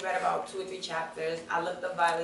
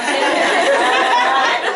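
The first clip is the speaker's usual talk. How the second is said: big loud laughter; 4 semitones higher, 13 dB louder than usual. Real, not acted. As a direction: burst out laughing. Speaker laughs.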